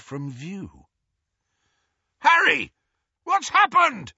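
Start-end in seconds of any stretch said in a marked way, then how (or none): 2.2-4.1 high, loud bellow of alarm; alarmed holler over engine noise. A narrator's reading. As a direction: bellow the question as Hagrid. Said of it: "bellowed Hagrid".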